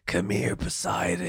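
low, hushed beckoning voice; mysterious calling voice. Acted. Friend mimics the voice calling from the bedroom, low and hushed. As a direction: lure them in softly, starting low. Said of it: "A voice".

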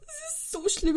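cracking up at herself; laughing. Real, not acted. Vlogger laughing at her own cat-mom habit.

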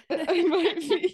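giggling it out slowly; laughing. Real, not acted. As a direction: laugh through the words. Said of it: "Are you high?".

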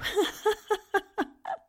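high tittering giggle; high, staccato laugh. Acted. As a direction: titter in quick high bursts. Laughs at own retort.

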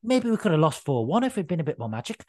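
a hint of a shrugging Gerrard; Stevie G impression. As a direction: lightly imitate a deflated manager. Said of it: "Stevie G said".

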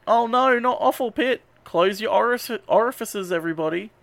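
high-pitched playful dismay; hammed-up warning, starting high and easing down; mock horror. Warning everybody falling into the offal pit, mock horror.

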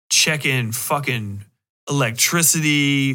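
ordinary delivery

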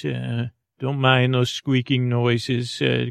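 ordinary talk

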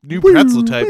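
high, loud fanfare announcer call; hyped announcement. Pretend. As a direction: boom it out like a game-show jingle.

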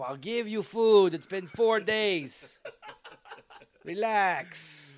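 high, loud and slow, hammed-up exasperation; exasperated captor. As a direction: yell down at the prisoner to settle down. Voicing captor to basement prisoner.

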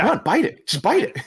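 high-pitched mock goading; urgent egging on. Imitating Mac egging him on.

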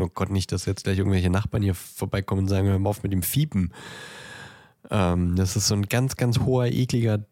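ordinary talk, nothing marked

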